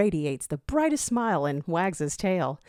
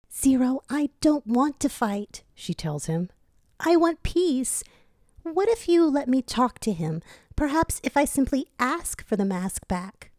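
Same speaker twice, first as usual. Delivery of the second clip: gentle Tikal voice; 8 semitones higher than usual. Acted. Tikal pleads with Zero, in a high gentle voice.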